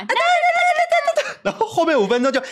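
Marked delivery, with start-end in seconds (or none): 0.0-1.3 rattled-off high da-da-da through a laugh; laughing high-pitched playful chatter. Retold or imitated. Host imitating kids at play, high giggly babble.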